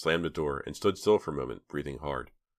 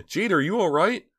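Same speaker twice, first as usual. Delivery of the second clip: anxious; 7 dB louder than usual. A narrator's reading. Girl anxious over injured friend.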